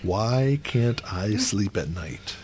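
soft and slow, drawn out; slow quiet dramatic read. Shown as a demonstration. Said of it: "Question mark".

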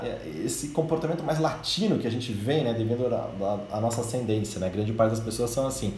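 ordinary delivery

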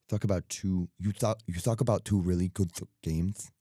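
accidental lisp on "games"; tongue-tied, lisped slip. Real, not acted. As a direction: stumble on "good th-, games", tongue slipping into a th. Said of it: "that was lisp there".

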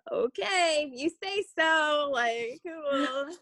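a mild mock actor going along with the director; Robert Pattinson shrugging along. Imagining the actor answering his director, lightly voiced and slow. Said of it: "I'm sure Robert was like".

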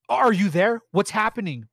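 urgent worried asking down the phone; worried boyfriend. A narrator's reading. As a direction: boyfriend on the phone, alarmed, asking what's happening. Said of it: "Brian asked".

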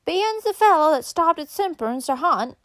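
high, loud backwoods holler; country woman's high call; calling after someone. A narrator's reading. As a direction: shout it after the departing rider. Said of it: "she called after him".

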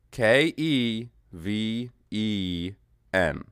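slow letter-by-letter K E V E N; spelling out Kevin. Shown as a demonstration. Reading out his spelling K E V E N to the stream, slowly. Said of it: "this is how he spells Kevin".

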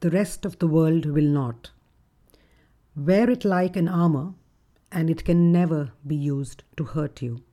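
normal talking voice

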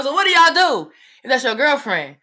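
high, loud retold interrogation; loud questioning. Mother questions her child about the girlfriend, loud and demanding. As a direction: imitate mom demanding an answer, high and loud.

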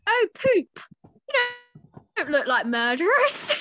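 high, loud sheepish voice; embarrassed girl. Acted. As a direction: own the blunder awkwardly. Caught out, embarrassed.

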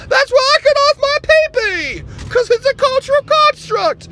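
high, loud indignant activist voice; outraged liberal caricature. Acted. Liberal character again.

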